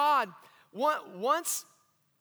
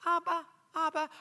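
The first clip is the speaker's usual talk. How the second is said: put-on high child's voice calling Abba, Abba; little boy calling; 9 semitones higher than usual. Little boy tugging at his dad's trunks, high pleading call. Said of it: "And at first he's like".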